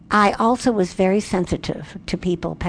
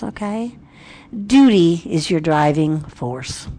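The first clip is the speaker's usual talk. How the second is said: normal talking voice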